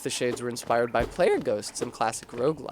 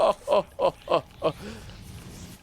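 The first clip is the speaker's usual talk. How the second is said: sly character cackle; 6 semitones higher than usual. Acted. Character's laugh after line.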